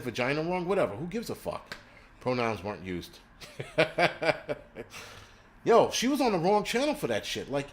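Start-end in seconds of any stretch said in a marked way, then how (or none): 3.4-5.2 long, real chuckle; amused laugh. Real, not acted. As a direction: laugh at your own joke. Laughing at his own remark.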